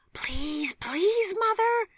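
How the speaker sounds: high, half-voiced pleading whisper; eager child stage whisper; loud whisper. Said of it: "at the top of her whispering voice".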